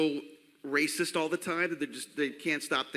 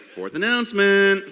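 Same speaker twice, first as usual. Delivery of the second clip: mock announcement; 4 semitones higher, 10 dB louder than usual. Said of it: "Corey's like".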